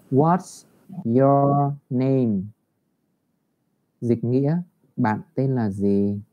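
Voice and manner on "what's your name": very slow, deliberate teacher model; slow model reading. Shown as a demonstration. Teacher rereads the model for the class, very slow. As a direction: drag each word out for the class. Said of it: "Thầy đọc lại nhá".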